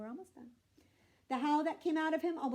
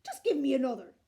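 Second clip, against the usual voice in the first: loud proud giant; loud blustering giant; 2 semitones higher, 7 dB louder than usual. A narrator's reading. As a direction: bluster to save face. Giant insists loudly.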